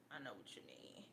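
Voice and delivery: soft sympathetic whisper. Real, not acted. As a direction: breathe it out after a sigh. Sighing empathy with a friend.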